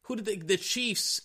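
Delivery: high, sing-song mockery of the doubters; high mocking fan voice. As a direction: parrot the know-it-all fans in a high silly voice. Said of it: "Everybody's like".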